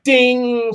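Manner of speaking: long, high drawn-out "ding"; imitated ding. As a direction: sing out a ding and hold it.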